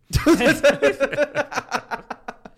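big burst of laughter; loud shrieking laugh; loud high laugh. Real, not acted. Laughs at terrified Andrew.

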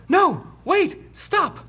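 very high-pitched urgent cry; voice from the cell. Acted. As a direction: squeal out a plea to halt. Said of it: "you hear".

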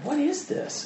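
puzzled wonder. Retold or imitated. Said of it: "he's like".

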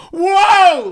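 high, loud startled whoa; startled yell. Retold or imitated. Startled by the bat in the theater, loud high yelp. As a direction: jump out of your skin and yell. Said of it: "and I go".

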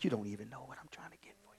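fast soft whisper; rushed whisper. Real, not acted. Preacher voicing God, rushed whisper. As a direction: rush it under your breath.

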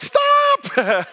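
very high, loud retold yell; hammed-up high shriek to halt; panicked shout. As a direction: cry out in comic alarm. Acts out his panicked cry.

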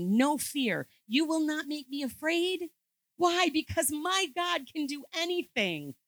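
rally the congregation with conviction; preaching at full force. Acted. Preaches at peak intensity.

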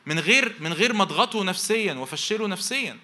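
high, loud sermon voice pressing the point; impassioned preaching. Acted. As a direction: drive it home to the parents. Preaching at full force.

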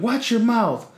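high, drawn-out mock scolding; retold scolding. Passing lady scolds a teenager, retold in high mock reprimand. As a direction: imitate an indignant lady telling someone off.

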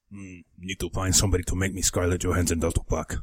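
musing in a crude mocking Chinese accent; a deliberately bad Chinese accent, thinking aloud. Co-host voices a buyer planning a custom doll in a bad Chinese accent. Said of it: "Sorry about the racist accent".

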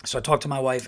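usual speaking voice

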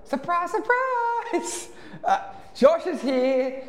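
very high, slow singsong call at the door; high drawn-out teasing call with a laugh; knocking friend. Retold or imitated. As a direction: sing it out like a cheeky visitor at midnight. Retelling friend knocking at midnight.

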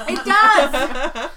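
high, loud burst through laughter; laughing agreement. Real, not acted. Agreeing fruit snacks unite everyone, laughing exclamation. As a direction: exclaim it while cracking up.

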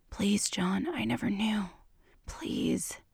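whispered begging; soft pleading whisper in his ear; desperate whisper. A narrator's reading. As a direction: plead with almost no voice. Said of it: "I said softly, desperately".

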